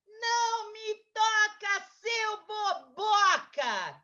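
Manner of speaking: high, loud, slow cry of refusal; gritando. Acted. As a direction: shout it out, stretching each word. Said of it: "Ela gritou assim". (grito alto e arrastado)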